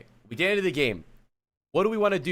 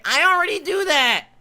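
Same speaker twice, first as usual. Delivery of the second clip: high, nasal-free mock whine, much higher; mocking whiny player; 11 semitones higher, 5 dB louder than usual. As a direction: parrot his excuse in a high mocking voice. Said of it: "and you say".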